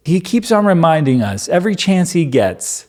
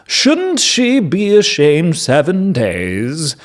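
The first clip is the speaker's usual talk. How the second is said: slow, raised solemn reading; dramatic scripture reading; 3 semitones higher, slower than usual. Acted. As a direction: read the verses with weight. Reads Moses and Yahweh.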